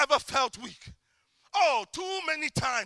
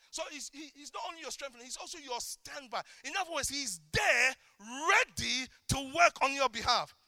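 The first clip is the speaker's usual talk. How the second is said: normal talking voice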